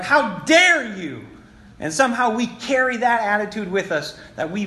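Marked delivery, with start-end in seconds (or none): 0.0-1.2 scolding parent. Retold or imitated. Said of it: "scolded you, like".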